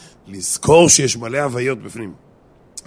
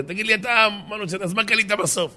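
high-pitched acted question; curious friend; 9 semitones higher than usual. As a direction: pitch it up as a nosy pal asking. Friend asks what he bought. (חבר סקרן בקול גבוה)

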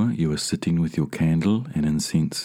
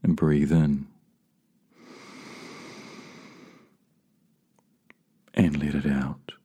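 slow, measured meditation guide with long pauses; spacious, calming delivery, letting each phrase settle; guided meditation; 3 dB louder than usual. Real, not acted. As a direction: lead them gently inward, unhurried.

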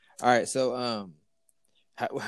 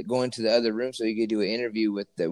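ordinary talk

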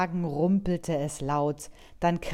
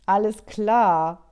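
grinning; 5 semitones higher, 7 dB louder, slower than usual. Acted. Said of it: "Merle grinste".

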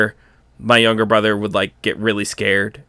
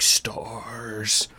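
softer, drawn-out monster whisper; Nemesis zombie voice; 9 dB quieter than usual. Retold or imitated. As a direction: breathe it like a monster hunting you. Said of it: "he had a little deep zombie voice".

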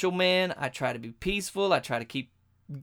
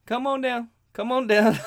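mock vendor beckoning, higher and louder; calling out; 6 semitones higher, 5 dB louder than usual. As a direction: call him down the stairs. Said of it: "he gives me the wave like this".

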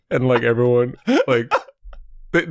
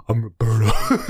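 mock butch voice; 3 semitones lower than usual. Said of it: "Like, mad butchy".

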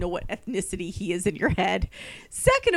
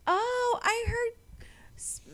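high, slow, sugary inquiry; prim vendor lady; 12 semitones higher, slower than usual. Retold or imitated. Vendor asks about her sister, high and slow. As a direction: mimic the vendor lady's sweet tone. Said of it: "asked me".

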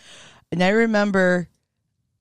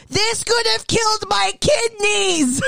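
high, slow, overblown speech; mock grand declaration; slower than usual. Girl with pee towel declaring to teacher, mock tragic. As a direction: proclaim it like a tragic heroine.

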